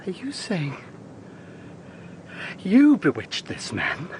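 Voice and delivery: hushed, appalled whisper; horrified whisper. Acted. Lawyer whispers in horror to the confessing witch. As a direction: barely dare to ask it.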